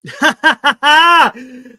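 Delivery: a sudden loud laugh; loud burst of laughter. Real, not acted. Reacts to chat joke.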